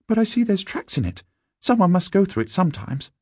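very high, piping schoolboy; schoolboy character. A narrator's reading. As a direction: pitch right up as a young boy. Narrator voices Wilfred.

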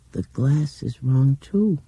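put-on high, slow dreamy child; dreaming voice. A narrator's reading. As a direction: drift upward, half in a trance. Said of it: "she said in that dreaming voice".

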